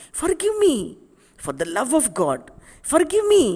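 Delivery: high, loud, desperate begging; pleading. Acted. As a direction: cry out for forgiveness. Confessing murderer begs forgiveness.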